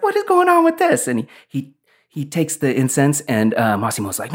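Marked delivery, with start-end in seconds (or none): 0.0-1.1 very high, incredulous imitation; high-pitched suspicious questioning; mock indignant demand. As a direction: act out a guy demanding an explanation, pitch way up. Acting out guy questioning the incense.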